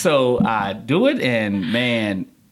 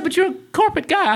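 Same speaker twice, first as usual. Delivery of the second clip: high-pitched retold challenge; incredulous lady; 3 dB louder than usual. Lady challenging a corporate guy's ignorance, incredulous. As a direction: object in disbelief, voice pitched up.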